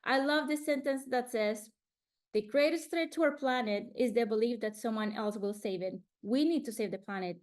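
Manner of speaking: normal talking voice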